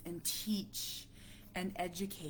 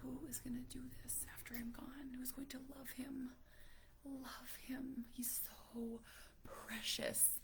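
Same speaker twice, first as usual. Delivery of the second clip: hushed, much softer private worry; whispered inner thoughts; 5 semitones higher, 12 dB quieter than usual. Retold or imitated. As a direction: whisper the fear in her head. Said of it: "I have these gut punch thoughts".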